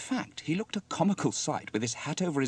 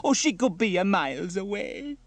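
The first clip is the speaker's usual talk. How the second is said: put-on high, loud Italian-accented despair; Bella lamenting; 10 semitones higher, 7 dB louder than usual. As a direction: wail with theatrical worry. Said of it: "cried Bella".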